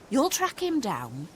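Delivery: ordinary delivery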